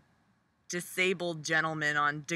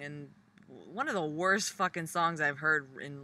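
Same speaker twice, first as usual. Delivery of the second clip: ordinary talk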